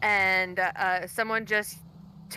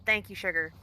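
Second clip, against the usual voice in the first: put-on southern accent. Southern charm role voice.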